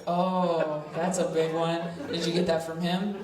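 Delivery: normal talking voice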